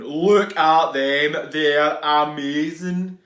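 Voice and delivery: loud, slow, amazed; slow loud wonder at the snails; awestruck. Acted. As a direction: savour every word in awe. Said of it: "said Norman".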